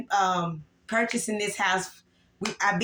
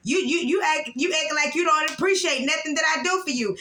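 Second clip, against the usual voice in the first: real anger, raised; 7 semitones higher than usual. Real, not acted. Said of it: "I'm so angry right now".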